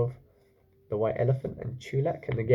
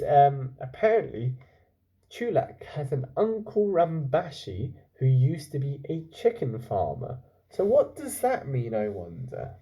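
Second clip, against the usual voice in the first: plain reading voice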